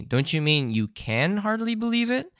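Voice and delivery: querying correction with a long rising finish; correcting question. Retold or imitated. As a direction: quote a correction, lifting the end right up. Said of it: "gets corrected by Nyong Granny".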